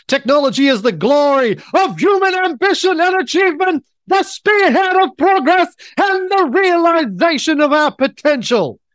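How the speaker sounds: screaming Hitler-style fake German rant; dictator rant. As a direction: shriek it like a ranting dictator. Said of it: "To be read either as Davros or Hitler".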